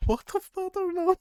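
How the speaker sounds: incredulous high-pitched yelp; shocked disbelief. Real, not acted. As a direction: blurt it out in disbelief. Shocked at stick of butter.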